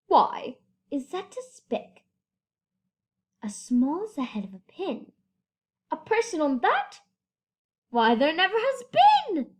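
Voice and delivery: a hint of a sneering kangaroo; scoffing kangaroo. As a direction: sour kangaroo scoffing at Horton. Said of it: "kangaroo in her pouch said".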